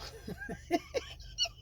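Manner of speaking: helpless, very high-pitched laughter; high squealing laugh. Real, not acted. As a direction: crack up into a squeal. Cracks up at the quoted accusation.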